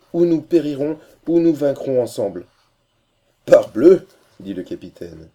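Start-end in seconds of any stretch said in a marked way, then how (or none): 0.1-2.5 loud, high declaration of resolve; impassioned vow. A narrator's reading. Sita vowing to stay beside her father, impassioned. 3.5-4.1 loud, high burst; hearty exclamation. A narrator's reading. Captain responding to Sita's brave vow, loud exclamation.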